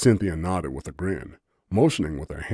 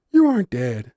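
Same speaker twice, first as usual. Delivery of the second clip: laughing; 9 semitones higher, 8 dB louder than usual. A narrator's reading. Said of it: "she replied with a laugh".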